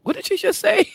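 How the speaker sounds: stunned reader. Retold or imitated. Reader stunned by a line in the book, loud and high. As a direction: stop short in disbelief. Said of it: "I'm like".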